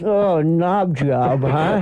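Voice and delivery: lightly voiced comic character, drawn-out; slow, mild impression of an old-school comic; Buddy Hackett. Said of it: "Buddy goes".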